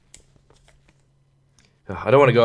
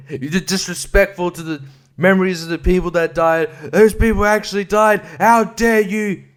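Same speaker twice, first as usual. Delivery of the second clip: mock indignant outburst; 5 semitones higher than usual. Escalating mock outrage.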